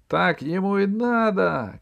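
high-pitched gloating cry; crowd jeer, shouted. A narrator's reading. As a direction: call out like a jeering crowd. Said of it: "кричали люди". (злорадный выкрик из толпы)

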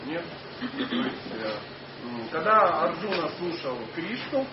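ordinary talk, nothing marked

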